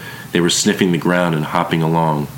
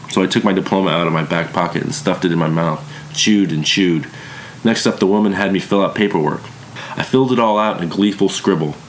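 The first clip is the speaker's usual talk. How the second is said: plain reading voice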